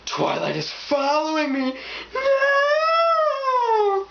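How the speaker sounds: gasping, high horror-movie scream; overdramatic horror. Acted. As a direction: wail in hammed-up terror, ending in a long shriek. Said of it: "an overdramatic".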